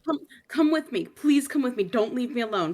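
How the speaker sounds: scared mother begging her protector; pleading. Acted. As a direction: beg him not to leave. Liv begs lord to stay.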